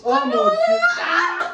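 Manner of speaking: high laughing shriek; surprised shout. Real, not acted. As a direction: scream it out in shock. Reacting to the card.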